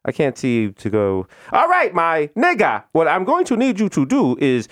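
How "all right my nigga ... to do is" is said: slow, deliberate mock Indian accent; patient scripted instructions in Indian accent; Indian agent's Bronx English. Agent walking caller through reboot, slow deliberate Indian accent. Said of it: "They start teaching them Bronx English".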